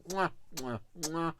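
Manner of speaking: blown kisses. Real, not acted. Blowing kisses to viewers.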